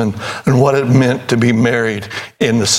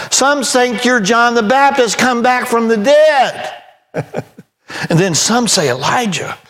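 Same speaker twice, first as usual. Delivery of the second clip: high, ringing sermon voice; fiery preaching; 9 semitones higher than usual. Real, not acted. Preacher to congregation, fiery pulpit preaching. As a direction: preach it hard against sin.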